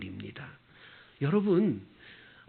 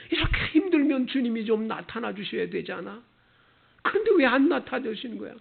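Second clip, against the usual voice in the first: whiny doubter; 7 semitones higher than usual. Retold or imitated. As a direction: whine like someone sure God has left them. Mimicking a doubting believer. (하나님을 의심하는 신자를 흉내 내며 투정하듯)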